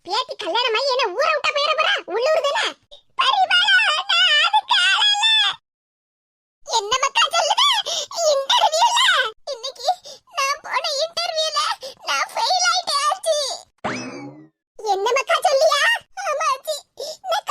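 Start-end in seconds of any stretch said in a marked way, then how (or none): 3.2-5.3 high, slow tearful wail; crying. Acted. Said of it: "என்னத்துக்கு இப்படி கண்ணீரை வடிக்கா". 8.3-13.4 sobbing confession in broken phrases; raised, tearful voice with gaps for sobs; crying. Acted. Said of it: "நீ அழற சத்தம் கேட்டு".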